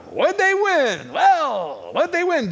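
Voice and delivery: booming high announcer call; high, drawn-out game show host voice. Pretend. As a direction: hype it like a TV prize reveal.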